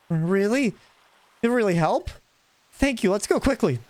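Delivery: high, grateful and eager; thrilled someone will help; relieved partner voice. Acted. Elliot thanks the hero, high eager relief.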